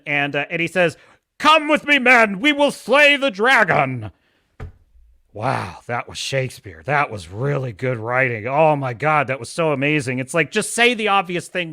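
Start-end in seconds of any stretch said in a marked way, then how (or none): 1.4-4.1 loud, higher heroic king's battle cry; rally the knights in a hammed-up royal call. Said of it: "The king is leading his knights". 5.3-10.2 low, deadpan mock admiration; sarcastic awe. Mocking the film's bad writing.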